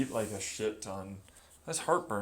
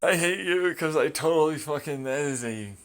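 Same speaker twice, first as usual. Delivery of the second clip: mock angry; 5 semitones higher, 5 dB louder than usual. Real, not acted. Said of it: "I'm gonna get angry".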